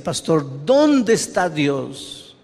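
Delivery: imitating a desperate churchgoer; desperate woman pleading. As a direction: beg like a woman who feels forgotten by God. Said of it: "una señora desesperada me decía".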